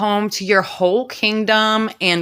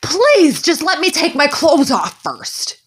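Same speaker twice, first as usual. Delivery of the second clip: high, loud hammed-up plea; high-pitched mock begging; begging girl; 9 semitones higher, 3 dB louder, slower than usual. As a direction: beg the queen on your knees, mock desperate. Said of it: "begs the queen".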